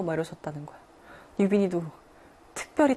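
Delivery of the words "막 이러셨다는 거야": ordinary delivery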